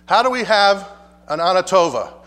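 normal talking voice

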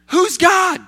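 high, loud shout; shouted question. Real, not acted. Preacher confronting the congregation, high loud shout.

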